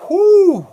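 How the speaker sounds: loud, high exhale of relief; big relieved whew. Acted. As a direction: blow out a huge whew like you just escaped. Acted relief after danger passes.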